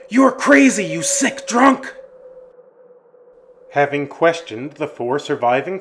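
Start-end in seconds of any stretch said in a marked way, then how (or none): 0.1-1.9 high, loud insult hurled back; fleeing yell. Acted. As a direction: yell back at him while running away. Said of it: "as they yelled".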